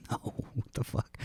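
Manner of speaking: whisper. Retold or imitated. Reply to daughter's broken bracelet.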